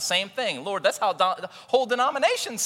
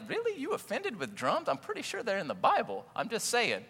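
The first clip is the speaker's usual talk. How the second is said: much higher and softer, teasing disbelief; mock incredulous; 7 semitones higher, 6 dB quieter than usual. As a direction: tease them for walking out over drums. Mocking family leaving over drums.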